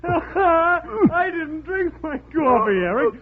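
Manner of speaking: gleeful reveal. Acted. Stan reveals triumph with laughter.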